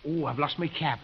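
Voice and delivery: ordinary talk, nothing marked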